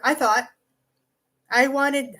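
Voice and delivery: ordinary talk, nothing marked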